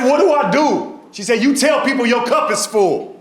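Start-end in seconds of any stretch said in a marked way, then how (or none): 0.0-1.0 high, pleading wail; mock panic. Patient asking the doctor for help, high mock panic. 1.4-3.2 high, emphatic mimicked advice; advising doctor. Doctor advising panicked patient, high and emphatic.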